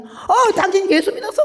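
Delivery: high startled squeak with a laugh; astonished nun. Retold or imitated. Nun realising the patient believes in Jesus, squeaking high. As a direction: yelp the question in disbelief.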